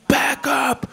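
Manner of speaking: very high barked warning; mock yell. Homeless man warns comedian, very high barked yell. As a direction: snap it out as the vagrant. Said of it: "And then he goes".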